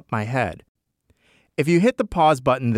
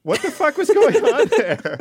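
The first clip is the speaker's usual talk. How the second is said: high laughing outburst; laughing disbelief; 6 dB louder than usual. Real, not acted. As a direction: laugh through the question.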